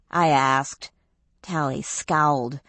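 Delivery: ordinary delivery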